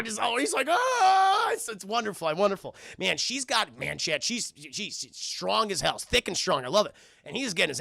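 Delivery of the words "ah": high, loud cry of pain; loud high mock yelp. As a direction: yelp as you get flung. Man being thrown around room.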